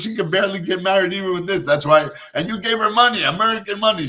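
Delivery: high-pitched, exasperated mock complaint; indignant protest. Aunt scolding mother for giving money away, indignant protest. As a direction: imitate an outraged aunt, pitched well up.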